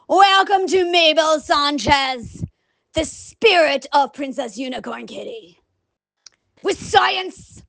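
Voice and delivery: slow, stretched-out theatrical welcome; show intro persona. Acted. Host introducing Princess Unicorn Kitty, theatrical show intro.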